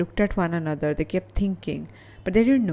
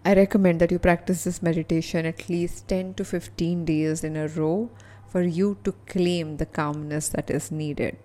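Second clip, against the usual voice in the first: usual speaking voice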